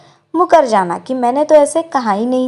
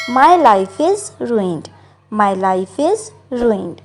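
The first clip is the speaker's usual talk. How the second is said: very slow, careful English model line; slow model sentence; 4 semitones lower, much slower than usual. Shown as a demonstration. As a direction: say each word slowly for learners. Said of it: "इसको कहेंगे". (धीरे-धीरे साफ़ बोला गया वाक्य)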